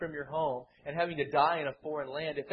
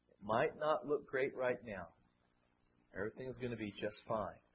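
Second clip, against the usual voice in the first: comforting words; 7 semitones lower, 10 dB quieter than usual. Retold or imitated. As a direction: soothe them like a gentle father. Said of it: "with good and comforting words".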